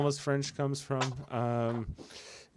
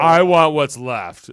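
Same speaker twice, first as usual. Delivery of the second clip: mock greedy voice; 10 dB louder, slower than usual. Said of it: "is what he's thinking".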